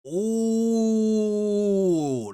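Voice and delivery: high, loud drawn-out ooh; a long hyped reaction sound; excited ooh. Real, not acted. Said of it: "that's how you rile somebody up".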